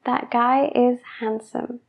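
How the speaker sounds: That guy is handsome slowly, D in handsome sounded. Shown as a demonstration. Teacher modelling the slow careful sentence. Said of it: "hablando lento".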